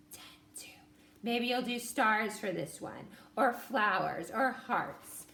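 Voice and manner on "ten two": whispered counting. Shown as a demonstration. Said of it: "whisper count as you do it".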